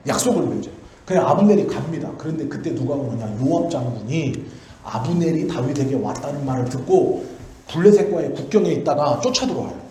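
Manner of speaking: ordinary talk, nothing marked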